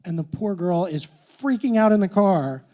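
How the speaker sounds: ordinary talk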